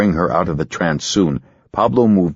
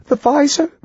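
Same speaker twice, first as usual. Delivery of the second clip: put-on terrified girl. Ginger in trance, high and terrified. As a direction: whisper the horror she sees.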